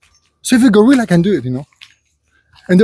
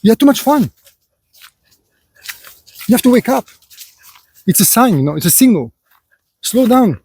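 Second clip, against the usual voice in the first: lightly voiced lecturing elder, higher and pointed; preachy lecture to son; 4 semitones higher than usual. Lecturing a complaining son about karma, mildly stern. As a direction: scold the meat-eaters like a stern father, a little higher. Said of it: "I'm like".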